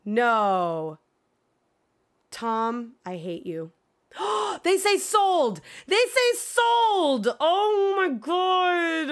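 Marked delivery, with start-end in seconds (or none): 4.2-9.1 high, slow, gasping disbelief; shocked excitement. Real, not acted. Streamer gasping to chat at the news, stretched high disbelief. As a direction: gasp and stretch the words out high.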